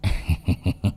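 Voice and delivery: a quiet mischievous chuckle; giggling laugh. Acted. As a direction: sneak a sly little giggle. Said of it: "cười khí khí".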